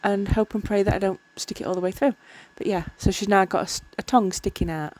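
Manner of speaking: ordinary delivery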